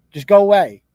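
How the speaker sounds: loud burst of exasperation; angry dismissal. Real, not acted. Streamer tells off the nurse he is ranting about, angry. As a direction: send them off with a loud, fed-up push.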